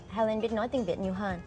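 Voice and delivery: usual speaking voice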